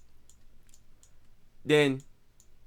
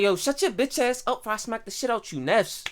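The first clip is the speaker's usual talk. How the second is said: pitched-up, soft-edged mock threat; mock confrontation; 4 semitones higher, 4 dB quieter than usual. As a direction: rattle off a threat in an acted argument. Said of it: "let's say you get into it".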